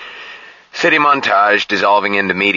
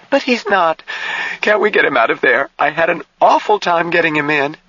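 put-on very high, distressed young woman; frantic protest; 12 semitones higher than usual. A narrator's reading. Jill begs Harshaw to get him out, frantic.

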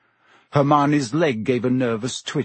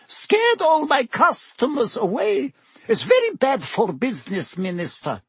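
slight edge; 12 semitones higher than usual. A narrator's reading. Landlady tells the Minister she's losing customers, slight edge. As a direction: drag out the grievance in a high voice. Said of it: "a slight edge to her voice".

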